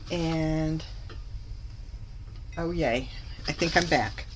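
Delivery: usual speaking voice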